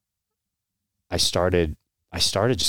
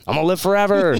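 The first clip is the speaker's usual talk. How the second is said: mock naive bravado; 12 semitones higher, 6 dB louder than usual. Naive young self quoted.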